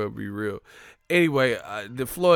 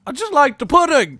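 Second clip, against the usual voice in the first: mock troll voice; 10 dB louder than usual. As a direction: shout it like a whiny troll. Mocking a troll commenter.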